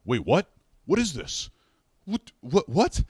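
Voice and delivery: retelling a guard's shocked repeated what; startled guard. Retold or imitated. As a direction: blurt it out in sudden confusion. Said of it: "he goes".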